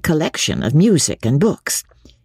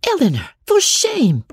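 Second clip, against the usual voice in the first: high-pitched shocked reproach; scolding. A narrator's reading. Marianne reproaching her sister over wealth, scolding.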